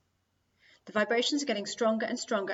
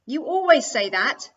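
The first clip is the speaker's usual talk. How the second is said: loud, drawn-out, higher accusation; sister's furious comeback; angry retort; 5 semitones higher, 12 dB louder, slower than usual. A narrator's reading. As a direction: snap back in anger, stretching the words. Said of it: "getting red in the face with anger".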